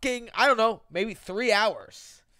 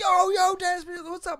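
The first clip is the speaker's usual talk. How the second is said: mock excited greeting; 9 semitones higher than usual. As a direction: hail a friend across the bar. Bar greeting retold.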